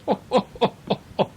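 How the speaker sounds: high hearty ho ho; engine's jolly laugh. A narrator's reading. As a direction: laugh like a big friendly train. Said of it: "the engine would go".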